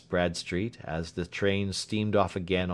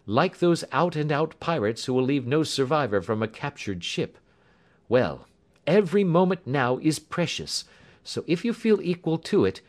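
animated, higher-pitched character voice; urgent story character; 7 semitones higher, 3 dB louder than usual. Acted. Urging companions to set off for Scotland Yard, urgent. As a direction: press on with brisk purpose, pitched up.

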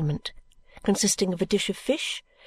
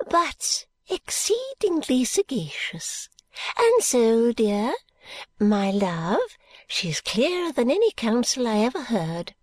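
Miss Flite voice; 3 semitones higher, slower than usual. Acted. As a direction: lean in and confide like a dotty old lady. Miss Flite's whispered praise.

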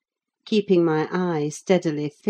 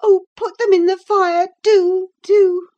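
high, loud frantic begging; desperate cry; 8 dB louder than usual. A narrator's reading. Girl urging Ellen to burn them, crying out. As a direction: implore in a high girlish wail. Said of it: "she cried".

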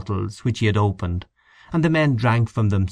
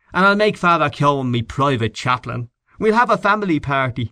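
a touch higher, mocking grandeur; voiced Mr Henchy; 7 semitones higher than usual. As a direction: lay out the daydream with a grin. Henchy imagines himself Lord Mayor.

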